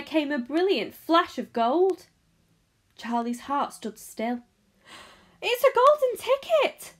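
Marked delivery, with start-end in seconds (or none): 5.4-6.9 high, loud shopkeeper's scream; piercingly high, thrilled outburst; excited scream. A narrator's reading. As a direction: scream it out in astonishment. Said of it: "screamed the shopkeeper".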